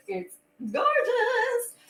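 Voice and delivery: high, slow gushing praise. Real, not acted. Singer gushes to viewers about album artwork, high and slow. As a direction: swoon over the artwork.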